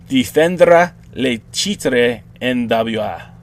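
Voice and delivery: slow, hammed-up French accent; a bad mock French accent; horrible French accent. Host tries the title in a bad French accent. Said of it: "That's my horrible French accent".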